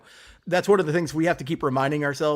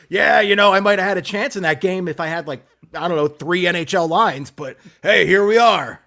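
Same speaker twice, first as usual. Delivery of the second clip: loud, hammed-up hockey coach voice; Tortorella impression; 7 dB louder than usual. Host mocking a coach who complains about his players, hammed-up impression. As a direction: grouse loudly like a fed-up coach, louder on the sign-off. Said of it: "that's my Tortorella impression".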